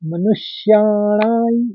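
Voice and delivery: मनुष्याणाई drawn out slowly, ending in ai; pronunciation demo. Shown as a demonstration. Teacher showing how to pronounce मनुष्याणाई. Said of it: "उच्चारण इस तरह से होगा".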